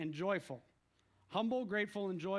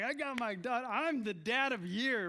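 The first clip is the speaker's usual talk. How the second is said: boasting dad, a little higher; excited dad; 3 semitones higher than usual. Retold or imitated. Dad bragging about his gift to his daughter, excited. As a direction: proudly crow as an excited father. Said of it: "the dad's all excited".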